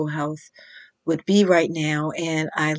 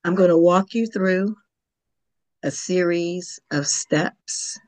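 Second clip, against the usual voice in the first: calm, unhurried Yoga Nidra guidance with long pauses; yoga teacher leading a guided relaxation, calm and measured; guided meditation. Acted. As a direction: gently guide the listener toward sleep. Leads a Yoga Nidra session.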